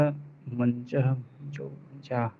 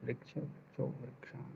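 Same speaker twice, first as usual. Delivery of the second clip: whisper; 25 dB quieter than usual. Real, not acted. Student quietly drilling declensions alone.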